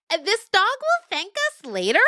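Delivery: put-on very high, bright kid's voice; piping little yearling voice; eager yearling goat.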